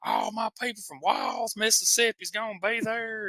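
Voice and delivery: very high-pitched imitation of a wrestler; mock promo cry. Co-host mimicking Bruno out there in the promo, high mock cry. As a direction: squeal it like a hyped-up promo.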